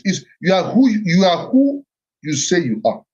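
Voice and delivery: ordinary delivery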